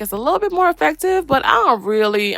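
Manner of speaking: ordinary talk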